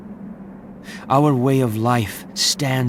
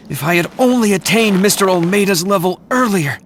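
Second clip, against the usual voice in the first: anguished regret; 6 semitones higher, 5 dB louder, faster than usual. Acted. Regret after master's death.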